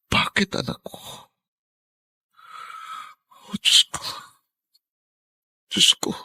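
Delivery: breathy, tearful plea to his son; grieving father's lament; anguished pleading. Acted. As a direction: plead through heartbreak.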